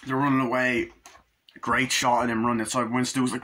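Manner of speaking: normal talking voice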